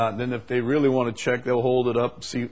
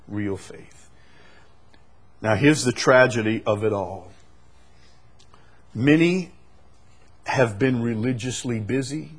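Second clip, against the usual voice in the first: ordinary talk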